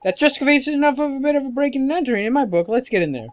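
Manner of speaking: high, quick fairy voice, rallying; decide briskly and urge the boy on; fairy sidekick. Acted.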